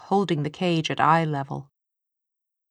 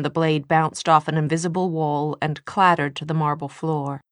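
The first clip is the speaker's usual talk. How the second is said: ordinary delivery, nothing marked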